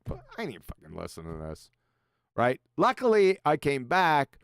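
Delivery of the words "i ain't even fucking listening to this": muttered retort. Retold or imitated. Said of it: "I said".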